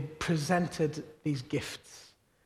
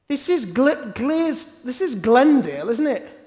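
higher, louder mock van driver calling out; 7 semitones higher, 8 dB louder than usual. Van driver calls from the window, higher and louder. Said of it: "He said".

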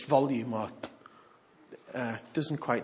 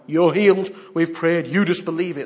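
pushy minister; 2 semitones higher, 10 dB louder than usual. Retold or imitated. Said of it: "tell them they're healed".

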